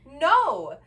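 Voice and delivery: loud, high, emphatic refusal; retold screamed refusal. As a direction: shout it back as one firm blast. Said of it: "I'm here screaming in his".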